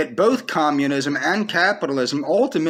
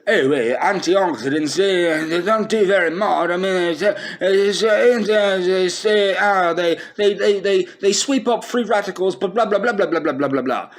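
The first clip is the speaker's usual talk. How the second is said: lightly voiced smug expert, stammering and dismissive; a hint of a condescending know-it-all; mock arrogant scientist; 3 semitones higher than usual. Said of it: "When he arrogantly says".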